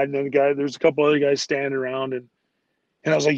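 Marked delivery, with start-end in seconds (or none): none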